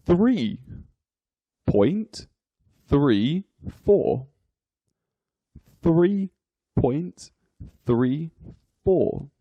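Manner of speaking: each word set apart by pauses; digit by digit. Shown as a demonstration. Teacher reading a decimal for learners, digit by digit. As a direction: read the decimal out one word at a time. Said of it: "each digit is read individually".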